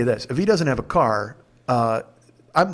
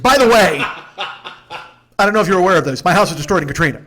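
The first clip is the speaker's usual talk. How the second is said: mock announcement; 3 semitones higher, 5 dB louder than usual. Mocking the host's abrupt bragging.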